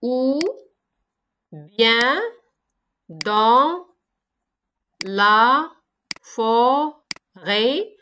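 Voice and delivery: each syllable said apart with long pauses; slow syllable-counting read. Shown as a demonstration. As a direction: tap out every syllable separately. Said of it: "Chaque vers se forme des syllabes".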